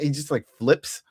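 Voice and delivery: ordinary talk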